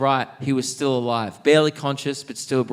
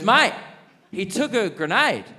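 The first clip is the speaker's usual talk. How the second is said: higher-pitched retold exasperation; exasperated; 7 semitones higher than usual. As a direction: set a fussing mate straight, acted out. Jungle fed up with repeated worry.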